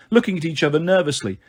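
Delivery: plain reading voice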